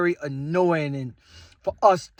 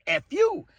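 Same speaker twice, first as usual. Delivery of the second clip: mock rude woman; 13 semitones higher than usual. Woman snaps back at him.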